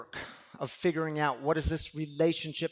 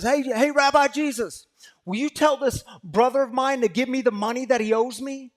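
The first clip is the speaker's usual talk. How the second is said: loud, much higher call across the crowd; shout across a crowd; 9 semitones higher, 10 dB louder than usual. Acted. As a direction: yell to get Jesus's attention over the crowd. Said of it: "someone shouts across the crowd".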